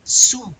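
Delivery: crisp teacher's model of "Sous", no final s; silent final S. Shown as a demonstration. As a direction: leave the last S of "Sous" silent. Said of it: "You gonna pronounce the S?".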